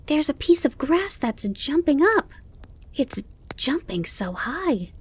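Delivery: put-on high, excited child voice; Bambi. A narrator's reading. Fawn telling his mother about the jumping grass, amazed. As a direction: marvel in a high young voice. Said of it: "called Bambi".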